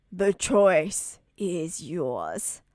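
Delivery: slow menacing. Acted. Villain giving ultimatum.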